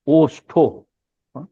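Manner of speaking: normal talking voice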